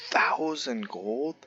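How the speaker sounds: can't believe the cost; stunned whisper. Real, not acted. Shocked at a huge price.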